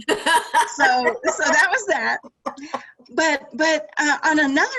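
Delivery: talking through real laughter; chuckling, loud and bubbling over; laughing while telling. Real, not acted. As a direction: laugh your way through the punchline. Laughing at the rookie story.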